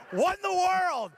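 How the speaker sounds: high-pitched incredulous outburst; retold exasperated disbelief. Storyteller finds extinguisher expired, high exasperated outburst. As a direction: cry out in mock disbelief.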